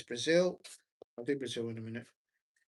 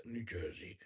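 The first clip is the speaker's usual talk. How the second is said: a halfhearted try at a New Jersey accent, much lower and softer; failed New Jersey accent; 7 semitones lower, 13 dB quieter than usual. Shown as a demonstration. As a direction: mumble "New Jersey" in a weak attempt at a New Jersey accent. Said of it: "I can't do the New Jersey accent".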